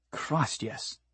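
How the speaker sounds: hushed, fading relief; whispered. Acted. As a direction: breathe it out softly. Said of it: "Des whispered".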